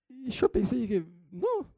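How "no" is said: no agudo burlón. Retold or imitated. Respuesta seca imitada.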